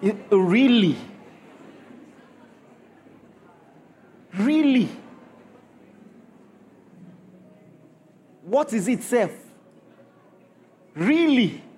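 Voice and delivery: loud disbelieving Really; mock outrage at an angry debtor; incredulous really. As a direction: throw the Really back at them in disbelief. Preacher mocks a debtor's anger.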